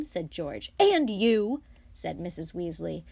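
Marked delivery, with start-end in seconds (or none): 0.8-1.6 loud, higher retort; snapping. A narrator's reading. Mother silences George too, snapping. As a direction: round on him sharply. Mother rounds on second son.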